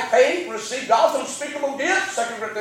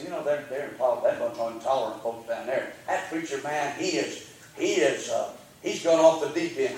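mocking outsider; 9 semitones lower, 6 dB quieter than usual. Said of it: "They come to Rocky Creek and say".

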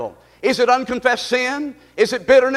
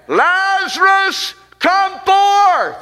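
high, slow, loud call to the tomb; Jesus calling Lazarus out of the tomb, commanding and loud; 8 semitones higher, 4 dB louder, much slower than usual. Acted. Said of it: "Jesus is standing ... saying".

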